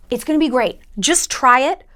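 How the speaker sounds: normal talking voice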